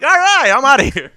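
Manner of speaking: high, loud retold exit line; mock loud bail-out. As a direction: call it out and bail. Retold hasty exit after rejection.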